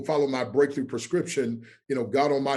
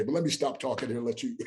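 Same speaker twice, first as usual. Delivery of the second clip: ordinary talk, nothing marked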